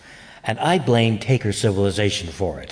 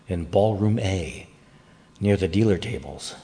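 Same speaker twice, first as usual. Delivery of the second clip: usual speaking voice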